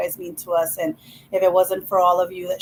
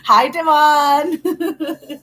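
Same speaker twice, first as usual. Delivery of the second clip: laughing greeting; 6 semitones higher, 4 dB louder, much slower than usual. Real, not acted.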